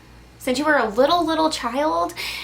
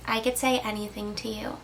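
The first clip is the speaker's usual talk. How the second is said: normal talking voice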